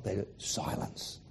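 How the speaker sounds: soft hushed whisper; slow awed whisper. Acted. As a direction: breathe the word into the silence. Describing hush at dawn.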